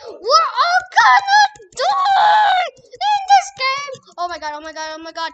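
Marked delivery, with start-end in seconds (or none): 0.1-4.1 slow, high drawn-out scream; panicked screaming. Real, not acted. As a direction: wail it out in terror. Terrified in horror game.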